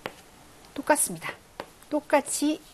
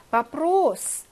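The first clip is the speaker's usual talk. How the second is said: 바프로스 with a long stressed o in 로; 5 semitones higher, 3 dB louder, much slower than usual. Shown as a demonstration. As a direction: lean on 로 in 바프로스. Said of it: "강세 있습니다".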